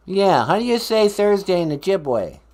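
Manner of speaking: loud, high mock Boston; Boston accent. Columbus asking host for a word, put-on Boston accent. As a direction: fire it off in fake Boston. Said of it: "talk with my Boston accent".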